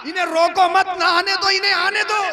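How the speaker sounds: high, loud ringing order; commanding call. Acted. Prophet telling companions to let the child come, commanding call. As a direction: call it out to a crowd of followers. Acted command repeated at full force.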